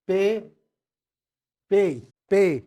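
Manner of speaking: पे said as "pay", long clear e; teacher drills पे slowly and loudly, not "pee"; German letter P. Shown as a demonstration. Teacher drilling German alphabet for students, loud clear पे. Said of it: "इसको बोलते हैं पे".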